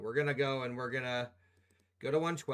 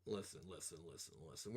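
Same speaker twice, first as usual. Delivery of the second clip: soft hush; 9 semitones lower, 17 dB quieter, faster than usual. Real, not acted. Calming chat down.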